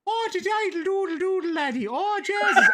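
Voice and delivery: high, hammed-up stage Irish lilt; fake Irish accent. Host ridiculing pretend Irish actors, fake Irish accent. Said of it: "people who were pretending to be Irish".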